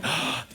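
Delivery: wife's stunned intake of breath; shocked gasp. Retold or imitated. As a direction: gasp in mock horror. Acts out wife's shocked reaction.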